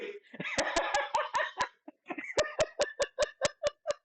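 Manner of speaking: long helpless high-pitched laugh; real high laughter. Real, not acted. Streamer cracking up at the cooking mess, high giggling fit. Laughing at husband's kitchen mishap.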